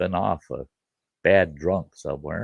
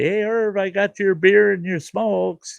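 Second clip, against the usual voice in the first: high, cheerful hey Irv call; 12 semitones higher, 4 dB louder than usual. Retold or imitated. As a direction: call out proudly with the goods. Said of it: "and say".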